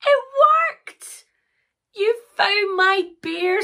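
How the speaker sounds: high, loud squeal of delight; overjoyed Sarah. Acted. Sarah getting her bear back, overjoyed. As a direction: cheer in a high, thrilled voice.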